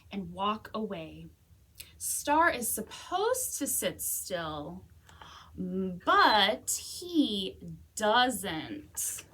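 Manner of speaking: lively, expressive storybook reading; animated picture-book read-aloud; reading to children. Acted. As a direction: read it like story time for kids.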